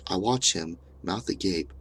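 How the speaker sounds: plain reading voice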